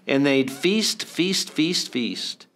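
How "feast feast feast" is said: ordinary delivery, nothing marked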